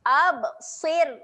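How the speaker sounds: "Absir" with a thin ra, lips spread. Shown as a demonstration. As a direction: smile on the ra in "Absir". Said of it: "kita kena senyum". ("Absir" ra nipis)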